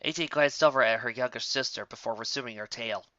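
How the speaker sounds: usual speaking voice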